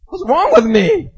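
loud, high-pitched comic dismay; mock self-reproach. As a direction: wail at your own stupidity, hammed-up. Retold self-reproach after the fight.

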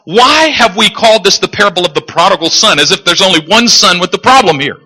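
ordinary talk, nothing marked

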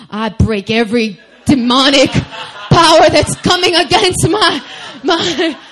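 loud, high, hammed-up battle prayer; mock warfare prayer. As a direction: imitate a boyfriend fervently rebuking demons, laughing through it. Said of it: "he started warring for me".

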